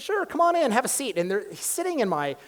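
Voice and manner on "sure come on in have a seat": bright, much higher, welcoming professor voice; cheery professor. Retold or imitated. Professor warmly welcoming a student into the office, cheery and high. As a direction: play the eager, happy professor greeting a student. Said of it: "we're all those happy professors".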